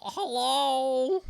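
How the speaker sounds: ordinary talk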